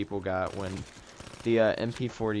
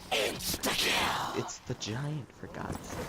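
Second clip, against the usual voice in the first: unvoiced, slow exasperated whisper; whispered frustration; 2 semitones higher, 5 dB quieter, much slower than usual. Real, not acted. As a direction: hiss it under your breath. Exasperated muttering.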